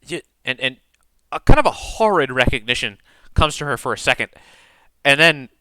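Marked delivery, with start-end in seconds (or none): none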